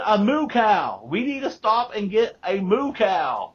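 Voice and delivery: high, loud mock exasperation; exasperated daughter. Daughter fed up with her joking dad, high and loud. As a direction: snap back at a teasing dad. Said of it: "She said".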